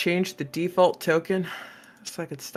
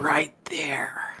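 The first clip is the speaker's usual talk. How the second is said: whispering; 2 semitones lower, 3 dB quieter than usual. Acted. Gm savoring a description.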